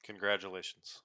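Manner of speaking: low, soft deadpan; unenthusiastic congratulations. Real, not acted. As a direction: congratulate with no enthusiasm at all. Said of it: "You can hear the enthusiasm in [name]'s voice".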